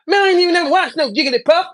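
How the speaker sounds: high, loud whining protest; imitating Swamp's squeaky denial; mocking whiny denial. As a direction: mimic a liar protesting too much. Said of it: "And he said".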